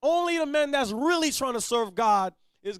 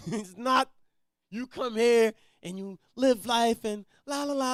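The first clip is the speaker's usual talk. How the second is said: usual speaking voice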